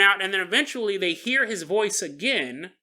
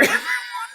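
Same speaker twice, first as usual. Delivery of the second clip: high laugh. Real, not acted. Laughs after shouting water.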